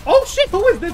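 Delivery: sudden loud high yelp of shock; jolted by an enemy, shouts out; startled yell. Real, not acted. To teammates, startled by an enemy, loud yelp.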